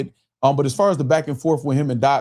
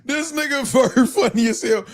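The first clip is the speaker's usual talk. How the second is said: laughing; 9 semitones higher than usual. Real, not acted. Still laughing after the clip.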